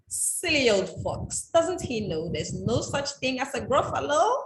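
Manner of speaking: mouse's gloating voice. Acted. Quoting the mouse from the book.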